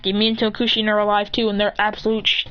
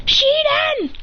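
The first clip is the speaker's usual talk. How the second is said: very high anime attack shout; attack call; 3 dB louder than usual. Acted. Hero obliterating them all, high-pitched attack call. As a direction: send the move name way up high.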